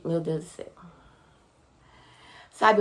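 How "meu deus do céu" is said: breathy whisper of relief; hushed awestruck whisper. Real, not acted. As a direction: breathe it out, overwhelmed. Seeing her baby arrive, relieved.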